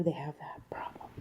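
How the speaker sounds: unvoiced whisper trailing off. Real, not acted. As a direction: whisper the end, absorbed in the task. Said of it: "why are you whispering".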